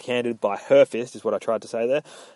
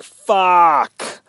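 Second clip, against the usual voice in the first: drawn-out, higher, louder exclamation; exasperated curse; 6 semitones higher, 6 dB louder than usual. Retold or imitated. Yorick balking at carrying the gun, exasperated curse. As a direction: let the swear burst out, stretched long. Acted outburst in retelling.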